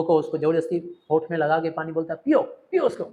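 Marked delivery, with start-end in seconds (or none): 2.2-3.0 high, pushy urging to drink; urging insistently. Acted. Chubukov urges the fainted Lomov to drink. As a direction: coax him to drink.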